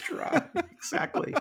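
squeaky high laugh-through; laughing agreement. Real, not acted. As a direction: agree while cracking up. Joke about cash delivery.

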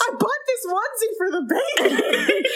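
high, laughing through a tearful quote; half-laugh the line, mock sobbing; laughing mock emotion. Cohost retelling his confession, laughing high. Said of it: "so emotional about it".

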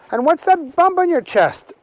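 a nosy little sister asking; curious Agnes. Acted. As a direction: sister quizzing Archie about the jacket, high. Agnes questions Archie.

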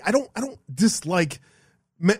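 usual speaking voice

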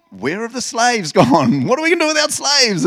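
high, loud mock dismay; bewildered Egyptians. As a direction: wail it as suddenly panicking slave owners. Voices bewildered Egyptians in scripture.

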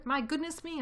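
high-pitched relieved outburst; excited exclamation. A narrator's reading. Lost badger finds his friend at last, exclaiming. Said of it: "cried Badger".

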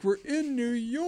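high, drawn-out gleeful cry; imitating a thrilled tourist; excited girl. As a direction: squeal it like you just arrived. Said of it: "She's like".

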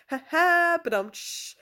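badum-tss for your own bad joke; mock rimshot. Host caps her expat wait-times joke, mock rimshot.

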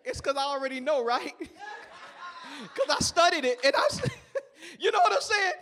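real laughter over the punchline he knows; laughing. Real, not acted. Pastor cracking up at his own sermon, laughing. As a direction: laugh through it, can't hold it in. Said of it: "That's how I feel right now".

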